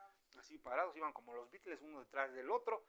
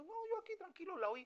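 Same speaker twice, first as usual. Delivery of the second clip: very high put-on voice; imitating an unmoved listener. A worshipper insists they just listened calmly, in a high mock voice. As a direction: play the untouched worshipper, pitched way up.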